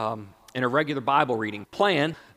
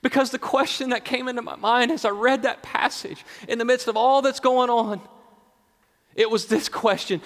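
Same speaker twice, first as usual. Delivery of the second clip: raised, forceful preacher's voice; preaching at full pitch; impassioned preaching; 11 semitones higher, 7 dB louder than usual. Real, not acted. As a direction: press the question on the congregation, high and strong.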